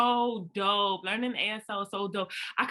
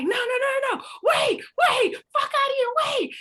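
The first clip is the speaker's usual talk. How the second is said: high panicked shout of no and wait; panicked yelling; 7 dB louder than usual. Retold or imitated. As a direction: scream it like you're about to be shoved off a bridge. Panicking as she's about to be pushed.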